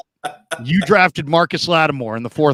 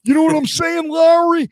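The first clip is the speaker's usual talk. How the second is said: high, squeaky exec voice, drawn out; high cartoonish impression of a GM; mock Trent Baalke; 13 semitones higher, 4 dB louder than usual. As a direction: ham up a squeaky general manager. Mocking Trent Baalke again.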